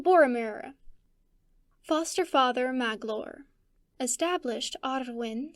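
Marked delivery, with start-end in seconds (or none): none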